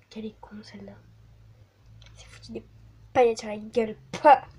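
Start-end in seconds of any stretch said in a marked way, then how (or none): 0.1-2.6 muttered whisper to herself. Real, not acted. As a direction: whisper under your breath. Whispered self-insult. (chuchoté)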